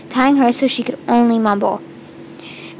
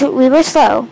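ordinary delivery, nothing marked

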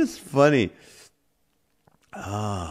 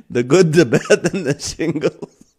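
laughing; 3 semitones higher than usual. Real, not acted. Laughing at own pun.

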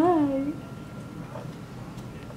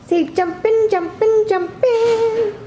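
higher and higher on each jumping; singsong coaxing; 8 semitones higher than usual. Real, not acted. Mom coaxing baby to say a word, rising singsong.

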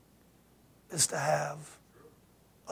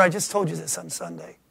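usual speaking voice

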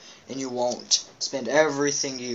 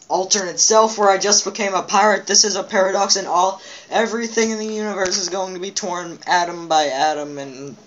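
dramatic; 6 semitones higher, 6 dB louder than usual. Acted. Dramatic doom narration.

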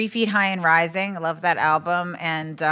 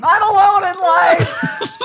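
long, high, slow comic yell; mock scream; 14 semitones higher, 8 dB louder, much slower than usual. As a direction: scream it out in hammed-up despair. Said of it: "And I just scream".

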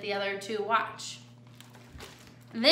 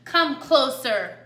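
slow, louder demand; 2 semitones higher, 7 dB louder, slower than usual. A narrator's reading. Coyote orders Jilly to approach, demanding. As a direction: order them nearer, slow and firm. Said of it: "the coyote demanded".

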